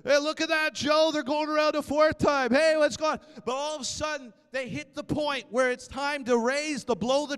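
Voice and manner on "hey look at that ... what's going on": higher, louder jeering townsman; mocking onlooker. Jericho watcher jeering at the marching Israelites to his friend Joe, loud and mocking. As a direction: call out to a friend to laugh at the marchers. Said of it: "They're mocking them".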